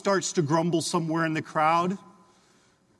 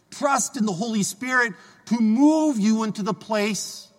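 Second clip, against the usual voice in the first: raised, slow, earnest appeal in Jesus' voice; fervent preaching; 4 semitones higher than usual. Acted. Priest urging the congregation to trust God, fervent slow preaching. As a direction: lift each trust in line slowly.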